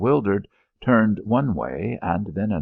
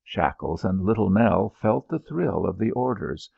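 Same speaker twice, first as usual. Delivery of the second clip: ordinary delivery, nothing marked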